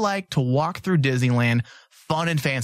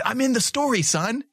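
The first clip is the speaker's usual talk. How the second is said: pitched up, laughing a little; teasing his son, raised pitch; playful high voice; 7 semitones higher than usual. Pretend. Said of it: "'Cause I'm like".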